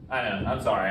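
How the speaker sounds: ordinary talk, nothing marked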